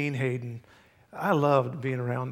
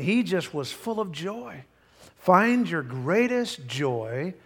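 ordinary delivery

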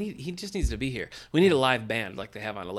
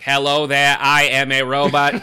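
much louder JFK-style robot; 2 semitones higher, 12 dB louder than usual. Retold or imitated. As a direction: boom it like JFK playing a robot. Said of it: "He'd be like".

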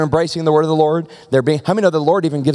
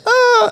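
sudden high, loud yelp; mock yelp; 5 dB louder than usual. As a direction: cry out as if slapped. Acted reaction to the slap.

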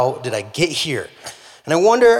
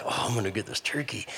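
a near-whispered vow, lower and softer; hushed eager; 4 semitones lower, 12 dB quieter than usual. Acted. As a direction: murmur it under your breath, keen to catch the turkey. Hunter alone sneaking up.

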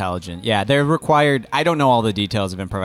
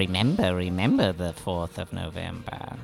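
soft, low ominous recital; ominous hush; 3 semitones lower, 7 dB quieter than usual. Pretend. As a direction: intone it like a dark omen. Quoting Guy Fawkes rhyme ominously.

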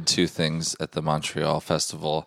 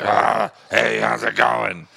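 imitated strained, yelled-out voice, higher and louder; terrible wrecked voice; 4 semitones higher, 4 dB louder, much slower than usual. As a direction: greet someone as if your voice is shot. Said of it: "your voice sounds terrible".

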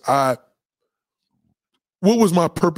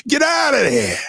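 mock shout; 10 semitones higher than usual. Retold yelling at himself to leave.

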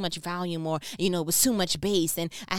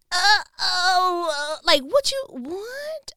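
much higher and louder mock alarm; stunned uh-oh; 11 semitones higher, 8 dB louder, much slower than usual. Real, not acted. Reacting in mock alarm to the coworker's swearing. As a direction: squeal in shocked disbelief.

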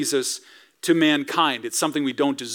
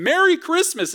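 cheery greeting; 12 semitones higher than usual. Retold or imitated. Cheery greeter in sermon example.

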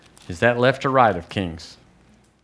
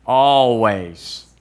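slow, stretched ALWAYS; drawn-out emphasis; 2 semitones lower, 4 dB louder than usual. Real, not acted. Preacher has the congregation say it along, emphatic and drawn out. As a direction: lead the room in one long "Always". Leads congregation in saying the word.

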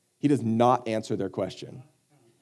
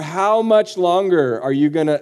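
loud, raised, fed-up question; acted impatience at full voice; exasperated complaint; 5 semitones higher, 6 dB louder than usual. Jesus to the doubting crowd, loudly impatient. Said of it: "Now you know why he says things like".

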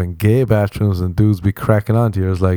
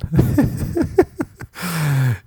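long high giggle; amused laugh; 8 semitones higher than usual. Real, not acted. Comedian recalling his awkward bathroom reply, long amused laugh. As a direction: break up at your own story.

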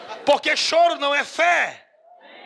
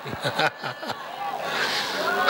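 breathy laugh; 10 semitones lower, 5 dB quieter than usual. Real, not acted. Holy laughter in sermon.

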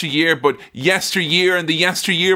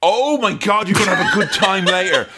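excited, rushed and high; gleeful exclamation; 4 semitones higher than usual. Real, not acted. Host promises friend a good time, gleefully excited. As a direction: burst out with laughing delight.

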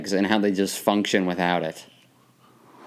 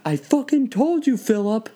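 very high-pitched telling-off; exasperated high pet-owner voice; mock dog scolding; 12 semitones higher, slower than usual.

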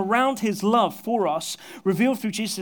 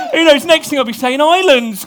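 laughing; 6 semitones higher, 14 dB louder than usual. Real, not acted. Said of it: "I was becoming more Brummy".

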